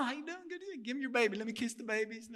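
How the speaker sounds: a hint of a baby-kissing pastor; glad-handing preacher. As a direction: play the preacher working the room.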